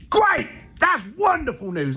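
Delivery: high, bright mock-cheerful reply; cheery reply. Client answers Steve's rant with a high cheerful reply. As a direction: shrug off bad news with a beaming grin. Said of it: "and he went".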